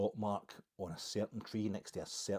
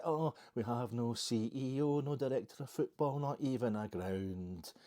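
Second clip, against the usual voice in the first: higher, slow mocking whine of panicking fans; 4 semitones higher, slower than usual. Pioneers looking down mock the screaming fans, high whiny mimicry. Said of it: "you're all screaming, is it".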